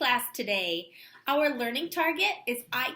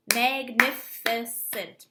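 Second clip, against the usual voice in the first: Magnificent clapped out slowly, MAG-NI-FI-CENT, louder; clapping syllables; 4 dB louder, slower than usual. Shown as a demonstration. Teacher claps syllables for her class, slow and loud. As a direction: say Magnificent slowly, one clap per syllable. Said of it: "clapping that word to see how many syllables".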